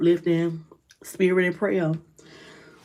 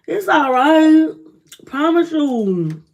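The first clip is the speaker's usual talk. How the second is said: reassuring; 4 semitones higher, 4 dB louder, slower than usual. Real, not acted. Reassuring viewers.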